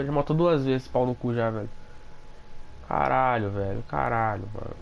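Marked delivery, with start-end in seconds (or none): none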